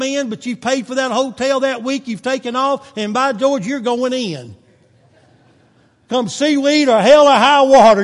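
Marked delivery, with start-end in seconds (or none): 6.1-8.0 emphatic shout. Real, not acted. Preaching emphatic.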